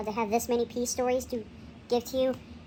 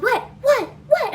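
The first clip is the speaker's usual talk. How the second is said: quick alarmed repeated what; startled mom; 11 semitones higher, 8 dB louder, slower than usual. Retold or imitated. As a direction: mom jolted, asking fast. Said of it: "And Mom's like".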